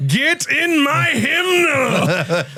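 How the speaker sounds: high, slow, stretched-out mock Scottish brute; Fat Bastard voice. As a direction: drawl it out like a cartoon Scottish slob. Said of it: "He sounds like Fat Bastard".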